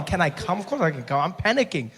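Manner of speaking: normal talking voice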